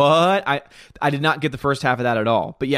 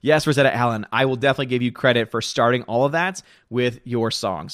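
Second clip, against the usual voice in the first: normal talking voice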